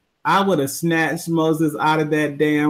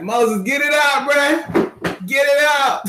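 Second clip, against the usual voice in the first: much higher, shouted mock preacher cry; shouting at Moses; 11 semitones higher, slower than usual. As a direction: holler it out like in church. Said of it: "I'll be like".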